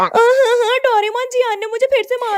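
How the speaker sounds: put-on whining kid. Nobita complains to Doraemon.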